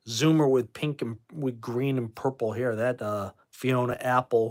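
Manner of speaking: ordinary talk, nothing marked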